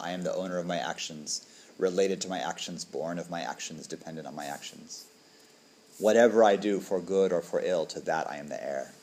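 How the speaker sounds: measured, quiet chant-like reading; reciting the five recollections, measured and solemn; liturgical recitation. Acted.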